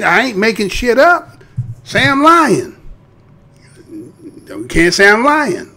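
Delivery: usual speaking voice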